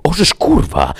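high, shocked yelp of a swear; startled curse. A narrator's reading. Winiarski startled by Orlo in the corner, yelping a curse. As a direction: jump out of your skin and swear. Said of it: "usłyszał w odpowiedzi".

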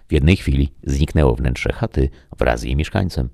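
plain reading voice

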